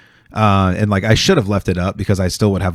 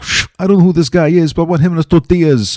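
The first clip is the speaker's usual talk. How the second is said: mob boss voice; 10 semitones higher, 5 dB louder than usual. Retold or imitated. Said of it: "He's like".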